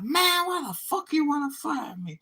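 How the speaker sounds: imitating the fired guy's high indignant whine; angry fired guy. Retelling the firing, mimics the guy's high outraged voice.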